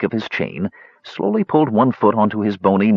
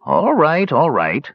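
high start, dropping as he gives in; exasperated consent; 6 semitones higher, slower than usual. Acted. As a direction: give in to the pestering. Cronin gives in to the begging.

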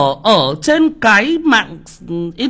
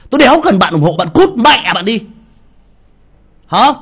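louder, cursing the commenter out; angry outburst; 5 dB louder than usual. Real, not acted. Streamer to a rude commenter, angry and loud. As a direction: snap at him to get lost.

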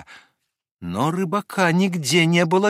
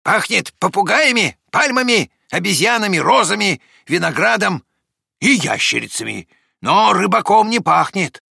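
lightly voiced dog, pitched higher; dog's declaration; 7 semitones higher, 4 dB louder than usual. A narrator's reading. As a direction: report the scents in a raised, lively voice. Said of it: "наконец, заявила". (собака заявляет, голос повыше)

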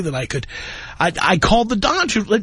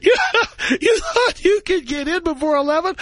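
high, cackling disbelief; mocking laughing clerk; 11 semitones higher than usual. Ticket clerk laughing at a newcomer wanting season tickets, high and cackling. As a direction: laugh the caller right off the phone. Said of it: "How many times we called Dolce".

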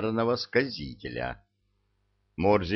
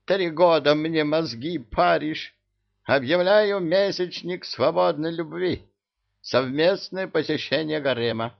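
excited ranting emir; 8 semitones higher than usual. A narrator's reading. Emir rants.

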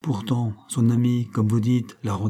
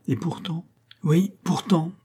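higher-pitched, emphatic insistence; énergique; 6 semitones higher than usual. A narrator's reading. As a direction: insist with energy on a rising pitch. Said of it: "ajouta-t-il avec énergie". (avec énergie, d'une voix plus haute)

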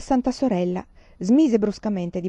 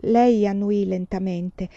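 usual speaking voice

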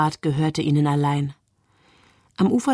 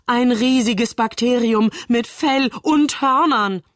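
high, drawn-out fake terror; mock panic; 7 semitones higher, slower than usual. A narrator's reading. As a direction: slowly build a hammed-up panic. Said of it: "rief Moritz in gespielter Panik". (gespielte Panik, übertrieben gedehnt)

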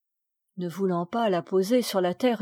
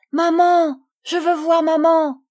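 put-on much higher and louder child's cry for mother; frightened little girl; 8 semitones higher, 8 dB louder than usual. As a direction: call out for maman like a scared child. (petite fille effrayée qui appelle sa mère, très aiguë)

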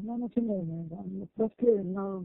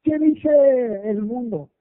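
drawn out, loud rhetorical question; a preacher's loud, slow challenge; slow emphatic question; 2 semitones higher, 8 dB louder, much slower than usual. Real, not acted. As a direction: lean on every word slowly. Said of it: "preacher asks the crowd".

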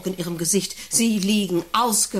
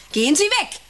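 high sharp shout, louder; shouting away; 12 semitones higher, 4 dB louder, slower than usual. Acted. Director yelling at the farmer's wife to leave. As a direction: drive her off with a yell.

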